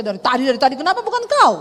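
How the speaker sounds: loud, indignant outburst; a loud mock-indignant snap at the husband; inner angry retort. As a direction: fire back at the husband in your head. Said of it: "dalam hati saya".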